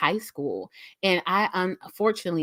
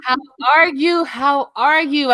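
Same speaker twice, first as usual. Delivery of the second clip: excited welcome; 7 semitones higher, 8 dB louder than usual. Real, not acted. Welcoming her guest warmly.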